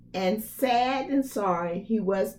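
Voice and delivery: ordinary delivery, nothing marked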